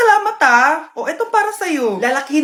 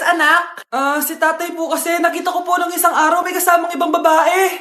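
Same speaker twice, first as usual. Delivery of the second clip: ordinary talk, nothing marked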